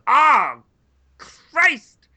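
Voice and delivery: loud, high mock outcry; crowd's exasperated cry. Imitating everyone at an interrupted big moment, exasperated yell. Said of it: "just so everyone goes".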